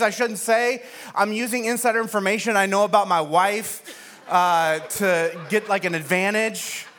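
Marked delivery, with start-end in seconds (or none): none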